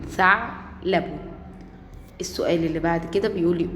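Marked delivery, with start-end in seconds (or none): 0.1-1.0 "ثعلب" broken right after the ayn; sukun stop. Shown as a demonstration. Teacher confirms the sukun answer, stopping at ع inside "ثعلب". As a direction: pause on ع in "ثعلب", then finish the word. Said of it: "السكون".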